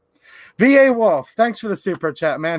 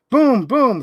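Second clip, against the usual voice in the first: higher, swelling boom on each beat; thunder booms; 4 semitones higher than usual. Acted. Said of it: "Mister Brown makes thunder".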